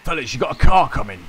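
put-on calling out, cockney lad accent; shouted London geezer accent; loud British accent. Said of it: "this voice is killing me".

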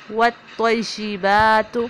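والطیبات slow, heavy ta, short final ta; correct recitation. Shown as a demonstration. Teacher demonstrating والطیبات. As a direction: recite والطیبات with a full-mouthed ta. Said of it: "توا کو پر پڑھیں گے".

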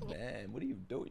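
normal talking voice

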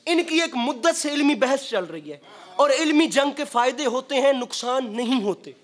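usual speaking voice

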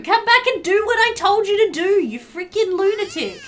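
high, loud frustrated scolding; nearly crying with frustration at the game; shouting at a sim who ignores orders; exasperated yelling. Real, not acted.

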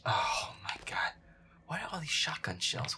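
normal talking voice

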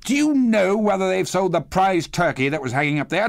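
high-pitched, eager questioning; delighted Scrooge. A narrator's reading. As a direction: bubble with excitement as Scrooge. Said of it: "said Scrooge".

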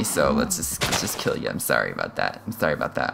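dropping to a low, soft mock-guilty apology; hushed apology. Real, not acted. To the cow before killing it, softly apologetic. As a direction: quietly say sorry to the cow.

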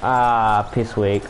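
usual speaking voice

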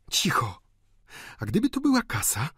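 put-on hushed word, then high eager old maid; żywo. A narrator's reading. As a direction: shush him, then speculate keenly. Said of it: "mówiła żywo stara panna". (żywy, wysoki głos starej panny)

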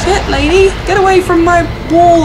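high, loud panicked yell at the lady; startled yell. Real, not acted. Fleeing the woman in the game, panicked yell. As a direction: shout her away in a panic. Monster chasing her in game.

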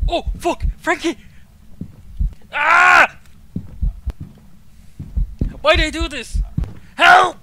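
loud frightened cries for friend; panicked yelling. Real, not acted. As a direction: yell in real panic. Alone in haunted house, scared.

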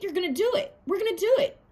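egging a friend on to skate, voice soaring; building hype. Retold or imitated. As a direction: hype them up, rising to a squeal.

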